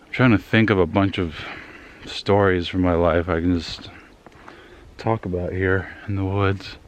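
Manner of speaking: ordinary delivery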